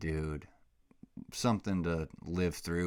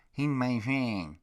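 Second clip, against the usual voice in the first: put-on baby talk; 6 semitones higher, 3 dB louder, slower than usual.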